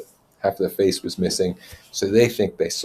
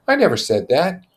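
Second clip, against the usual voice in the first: put-on high boyish protest; 11 semitones higher, 7 dB louder than usual.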